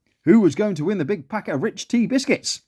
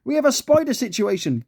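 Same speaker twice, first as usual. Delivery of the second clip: stern; 8 semitones higher than usual. A narrator's reading. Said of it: "replied Joyce sternly".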